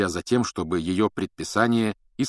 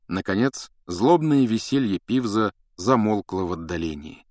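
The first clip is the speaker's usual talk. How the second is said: ordinary delivery, nothing marked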